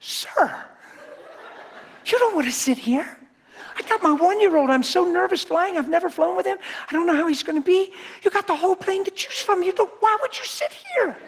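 high, panicky pleading voice; high-pitched fretful lady voice; panicked mother. Retold or imitated. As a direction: plead like a frantic young mom. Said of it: "She panicked. She said".

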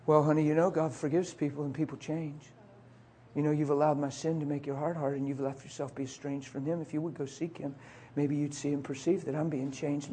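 soft, patient honey-voiced reply; mock gentle reply. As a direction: say it softly like a patient husband. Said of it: "what I could have said".

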